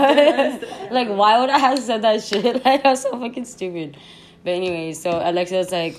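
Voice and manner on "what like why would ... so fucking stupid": loud, high, laughing through the words; incredulous, giggly outburst; laughing disbelief. Real, not acted. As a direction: crack up at your own silly remark. Laughing at own silly words.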